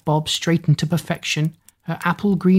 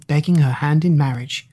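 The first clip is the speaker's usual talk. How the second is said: usual speaking voice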